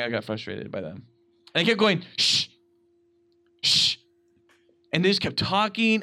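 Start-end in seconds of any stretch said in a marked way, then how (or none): 2.2-4.0 sharp drawn "shh"; hushing hiss; retold shushing. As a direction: imitate shushing noisy moviegoers. Shushing the talkers in retelling.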